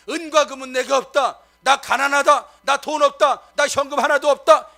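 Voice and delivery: loud, proud mock bragging; mock boast. Preacher mocking a boast of poverty to the church, loud. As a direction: brag loudly about being broke.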